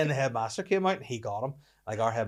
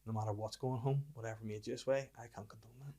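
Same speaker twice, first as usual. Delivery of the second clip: hushed, much softer retold reprimand; mock solemn talking-to; 9 dB quieter than usual. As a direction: fade almost to a whisper as you pretend to admonish.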